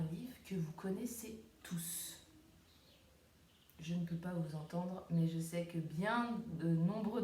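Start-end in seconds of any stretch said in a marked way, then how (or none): 0.0-2.2 put-on playful secret whisper to children; chuchoté, comme un secret. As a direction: whisper it like a surprise. Teacher whispers reveal to kids.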